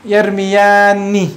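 slow model pronunciation of يرمياني; drawn out slowly. Shown as a demonstration. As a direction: stretch يرمياني slowly, syllable by syllable. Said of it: "أدخل نون التوكيد".

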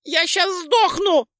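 slow, very high dying squeak; squeaky pixie. Acted. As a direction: squeak it in agony. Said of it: "пропищала Чопля". (жалобный писк пикси)

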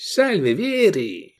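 high, sugary little greeting; squeaky. A narrator's reading. Little rat-like man hailing the owner, squeaking. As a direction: pipe a sweet hello, pitched right up. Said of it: "пропищал мужчинка, слащаво улыбаясь".

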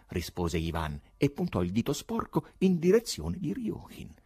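ordinary delivery

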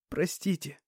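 soft, tearful whispered apology. A narrator's reading. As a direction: whisper it through tears. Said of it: "прошептал я".

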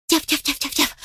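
high, quick puppy yaps; puppy barking. A narrator's reading. Puppy barks under grouse's nest, yapping. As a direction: yap to wake someone up. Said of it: "он громко залаял".

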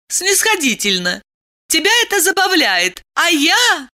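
high-pitched dignified woman; calm dignity. Acted. Woman defends her standing to lover, with dignity. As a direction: compose yourself and speak proudly, still high. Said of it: "внезапно успокоилась и с достоинством произнесла".

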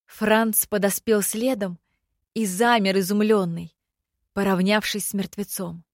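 plain reading voice

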